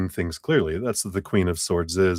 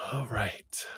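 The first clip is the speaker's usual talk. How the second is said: whisper; 2 semitones higher, 12 dB quieter than usual. Real, not acted. Quiet aside before resuming.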